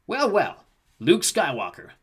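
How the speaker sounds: high, smug villain drawl; sneering bounty hunter. Acted. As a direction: taunt him like a cocky alien hunter. Bounty hunter confronts Luke.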